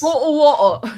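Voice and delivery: high, loud mock British; Cockney-ish bottle water; British impression. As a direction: clip bottle hard, British style. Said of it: "did a really good impression".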